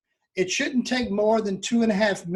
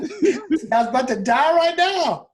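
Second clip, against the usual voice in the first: talking through a laugh; giggling while speaking; 7 semitones higher, 4 dB louder than usual. Real, not acted. Laughing at the joke.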